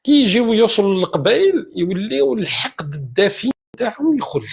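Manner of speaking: usual speaking voice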